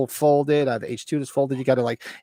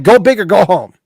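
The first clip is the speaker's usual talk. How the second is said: loud, higher mock Italian boast; loud motto; 5 semitones higher, 11 dB louder than usual. Man voices Italians boasting about huge posters, loud. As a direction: boom it out like a proud Italian. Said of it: "Italians are like".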